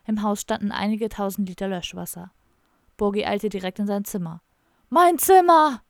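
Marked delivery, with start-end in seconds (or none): 4.9-5.8 roaring, much higher and louder; a full-throated howl of shock; bellowing. Acted. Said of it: "brüllte er". (entsetztes Brüllen, viel höher und lauter)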